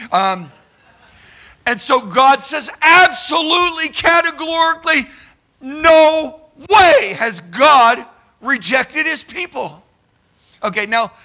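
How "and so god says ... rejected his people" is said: higher and louder, slowing on no way; emphatic preaching. Real, not acted. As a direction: hammer home the denial. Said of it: "preaching emphatic denial".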